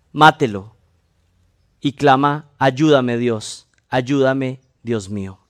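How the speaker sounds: a touch of a tearful plea, dropping away; mild crying plea. Acted. The man begs God for help, mildly tearful. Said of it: "casi llorando y clamando".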